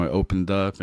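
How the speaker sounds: usual speaking voice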